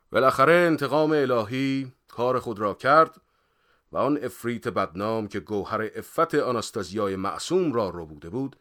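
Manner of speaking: loud, declaimed book reading. A narrator's reading. Character reads another novel to unwilling friend, loudly declaiming. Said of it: "شروع کرد با صدای بلند خوندن".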